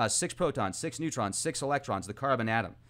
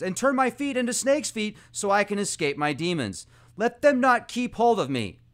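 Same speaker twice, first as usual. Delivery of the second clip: wailing plea; 5 semitones higher, 5 dB louder than usual. A narrator's reading. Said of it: "let out a wail and wept".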